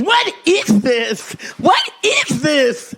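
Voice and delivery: slow, high, alarmed questioning; a nurse demanding answers, drawn out; startled nurse. Retold or imitated. Nurse finds the bag, startled.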